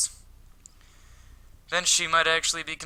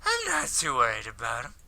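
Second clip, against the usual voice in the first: put-on drunk-sounding guy; 6 semitones higher than usual. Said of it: "I don't like this voice for him".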